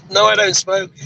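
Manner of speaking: prim refusal. Retold or imitated. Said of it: "And I went".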